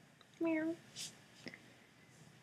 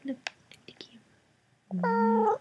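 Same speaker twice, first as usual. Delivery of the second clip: whisper; 9 semitones higher, slower than usual. Real, not acted. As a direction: coax the cat in a slow whisper. Whispering to the cat.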